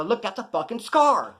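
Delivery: retelling how he snapped back at the nurse. Retold or imitated. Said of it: "raise my voice a little bit".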